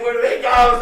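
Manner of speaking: loud high frantic shout; panicked shout. Acted. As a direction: yell it in a panic. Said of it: "It's like".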